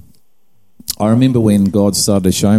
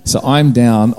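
normal talking voice

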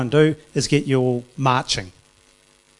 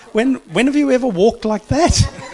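laughing, high and loud; 12 semitones higher, 7 dB louder than usual. Real, not acted. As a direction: laugh through the question. Laughing at marchers.

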